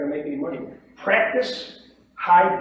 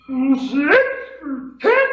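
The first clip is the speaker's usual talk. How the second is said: high, slow, loud bid with odd rising inflection; mock an uncomfortable trader squeaking out a questioning bid; unsure bid; 10 semitones higher, 7 dB louder, much slower than usual. Shown as a demonstration. Imitating unsure beginners bidding in the pit, mock unsure yelp. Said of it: "because they're unsure of themselves and uncomfortable".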